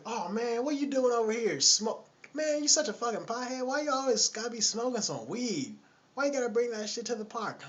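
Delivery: high, loud mock nagging voice; nagging woman. Lady scolds the pothead at the park, loud mock nagging. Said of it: "she's nagging at him".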